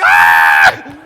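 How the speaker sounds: long, loud acted scream of terror. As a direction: scream out as if falling. Screaming as he is thrown out.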